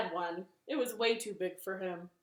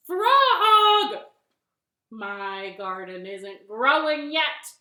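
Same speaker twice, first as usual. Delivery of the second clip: calling out in complaint; 2 semitones higher, 15 dB louder, much slower than usual. Acted. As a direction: call a friend over and grumble loudly. Toad calls Frog, complaining.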